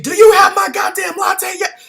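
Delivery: high, loud furious shout; angry customer. Retold or imitated. Mocking a late customer yelling at baristas. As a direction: bark it like a rude customer.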